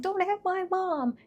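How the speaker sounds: put-on high pleading child's yell; desperate kid begging dad; childish cry. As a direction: cry it out as a scared little girl. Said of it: "just being like".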